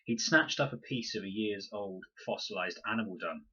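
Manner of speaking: plain reading voice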